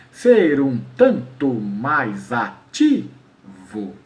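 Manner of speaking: drawn-out metrical count; counting syllables. Shown as a demonstration. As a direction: separate every syllable, last one set apart. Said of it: "então se eu contar aqui".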